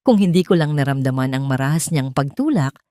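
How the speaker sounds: ordinary delivery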